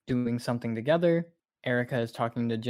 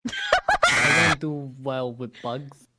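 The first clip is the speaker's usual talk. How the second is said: laughing; 6 dB louder, slower than usual. Real, not acted. Cracking up while confessing.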